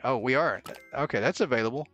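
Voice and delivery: ordinary talk, nothing marked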